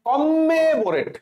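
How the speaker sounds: "কমেমোরেট" slow and high, doubled M held long; exaggerated spelling pronunciation. Shown as a demonstration. Teacher demonstrates how to remember spelling, "কমেমোরেট" drawn out high. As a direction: stretch "কমেমোরেট" as komm-me-mo-ret. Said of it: "আমি কিসের মতো উচ্চারণ করছি?".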